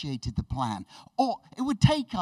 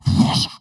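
loud imitated whoosh; big rushing whoosh; 12 dB louder than usual. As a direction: make a sudden loud swishing noise. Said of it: "and Jesus just goes, whoosh".

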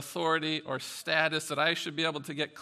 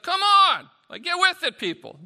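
much higher and louder, fed-up yell; frustrated shout; 14 semitones higher, 14 dB louder than usual. Retold or imitated. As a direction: yell at people to get it together. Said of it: "he's a little bit like".

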